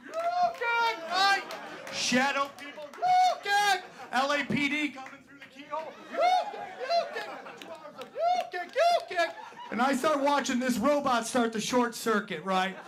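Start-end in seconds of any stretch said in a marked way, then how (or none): 0.1-9.3 high strained martial arts yelps; crazed battle cry. Pretend. High-on-crack comedian throwing imaginary fireballs, screeching high karate cries. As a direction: shriek like a fighting game hero on crack.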